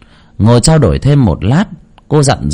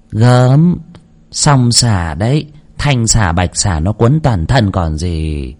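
higher-pitched, assured fortune-teller pronouncing a diagnosis; knowing spirit medium; 7 semitones higher than usual. Acted. Medium reads the woman's spiritual fate, high and knowing. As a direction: speak as a medium sure of her reading.

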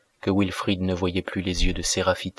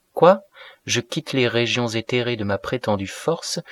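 higher-pitched, indignant plea; wounded reproach; 3 semitones higher than usual. Acted. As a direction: burst out, then press each phrase upward like a hurt appeal. Acted wounded reproach of spurning lover.

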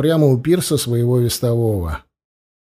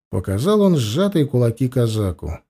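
usual speaking voice

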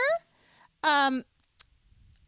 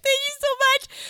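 much higher, louder, tearful; mock sobbing thanks; 14 semitones higher, 8 dB louder than usual. Mom thanking the vet, mock tearful. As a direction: gush it through mock tears. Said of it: "sobbing speech".

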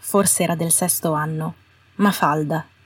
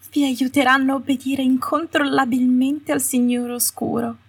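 sugary Umbridge; 6 semitones higher than usual. A narrator's reading. Umbridge continues her sweet speech.

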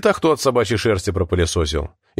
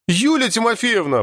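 exclamation; 12 semitones higher than usual. A narrator's reading. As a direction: cry out the name in mild protest. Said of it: "воскликнул Артём". (восклицание высоким голосом)

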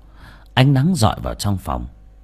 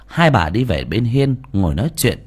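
ordinary delivery

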